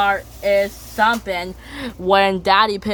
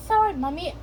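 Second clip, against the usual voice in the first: squeaky little piglet apology; high child voice; 8 semitones higher, 4 dB quieter, faster than usual. Retold or imitated. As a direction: pitch it up like a small child.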